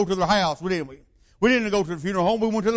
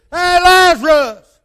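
slow, booming high shout of command; 11 semitones higher, 14 dB louder, slower than usual. Retold or imitated. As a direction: call the dead man out with full force. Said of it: "He said".

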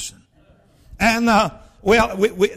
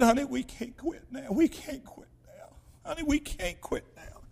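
soft, higher-pitched emotional plea; hushed pleading; 4 semitones higher, 11 dB quieter than usual. Retold or imitated. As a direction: plead quietly, voice thinned with feeling. Emotional plea to discouraged wife.

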